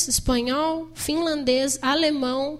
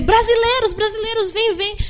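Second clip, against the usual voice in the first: eager calling; 7 semitones higher than usual. Retold or imitated. Guard calling them in.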